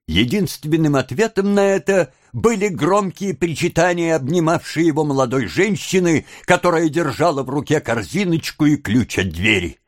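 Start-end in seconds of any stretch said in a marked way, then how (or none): none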